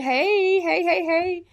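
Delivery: high, loud repeated hey; high chanted hellos; excited hey call. Real, not acted. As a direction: call hey out over and over like a cheer. Calling out greetings to chat.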